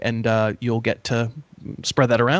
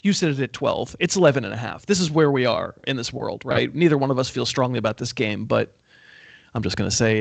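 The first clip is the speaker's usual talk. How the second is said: ordinary delivery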